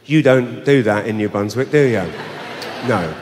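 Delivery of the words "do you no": ordinary delivery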